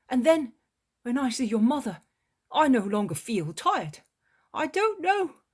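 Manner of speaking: high, loud voice of a cheerful fisherman; Peter, cheerful fisherman. A narrator's reading. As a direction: Peter heading home with the fish, glad. Peter speaks fondly of home.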